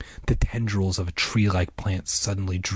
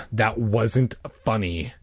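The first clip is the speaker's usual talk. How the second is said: ordinary delivery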